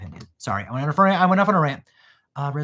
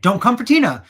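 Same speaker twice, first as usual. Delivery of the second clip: louder, higher warning to the haters; loud defense; 8 semitones higher, 8 dB louder than usual. Real, not acted. Vlogger warning viewers off Tina, loud and high. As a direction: call out the Tina haters loudly.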